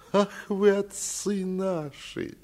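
high, drawn-out wheedling sing-song; singsong fawning. A narrator's reading. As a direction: croon it like a tearful flatterer. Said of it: "заговорил он нараспев и с таким умилением".

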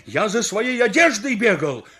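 high, loud exasperation; exasperated outburst; angry shout. Acted. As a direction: yell back at the crowd in protest. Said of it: "как закричу на них".

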